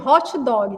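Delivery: "hot dog" as "hótchi dógui"; Brazilian pronunciation. Shown as a demonstration. Confirming how Brazilians say it, Brazilian pronunciation. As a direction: end both words of "hot dog" with an i. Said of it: "que a gente fala".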